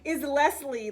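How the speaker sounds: big mock-showbiz welcome; playful announcer. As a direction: belt out a hammed-up stage introduction. Playful emcee call introducing guest.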